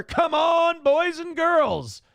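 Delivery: high, loud, slow plea to the chat; rally the crowd for money; calling out. Real, not acted. Streamer urging chat to donate, loud high plea.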